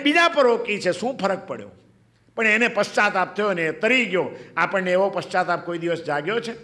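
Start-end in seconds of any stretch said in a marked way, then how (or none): none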